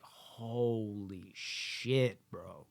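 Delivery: very slow, drawn-out low disbelief; stunned. Retold or imitated. Viewer stunned by the billion-dollar loss, slow disbelief. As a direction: let it sink in slowly, floored.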